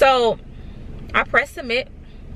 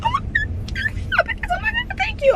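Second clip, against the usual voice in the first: very high squeaky mock excitement; imitating excited girl. Retelling a classmate squealing she passed, very high and squeaky. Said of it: "she like".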